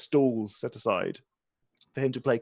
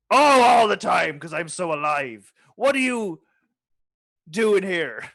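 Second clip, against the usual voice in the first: pirate captain voice; 6 semitones higher, 6 dB louder than usual. Acted.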